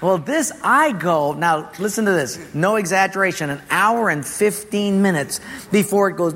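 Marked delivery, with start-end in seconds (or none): none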